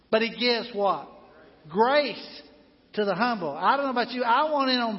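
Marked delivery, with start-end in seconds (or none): 1.7-2.4 GRACE called out higher and louder as the answer; emphatic answer. Acted. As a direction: land the word Grace like a punchline to the question. Preacher answers his own question.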